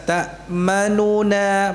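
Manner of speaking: Ta'manuna drawn out slowly; slow demo. Shown as a demonstration. Teacher repeating Ta'manuna slowly for students. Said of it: "masih jelas ya".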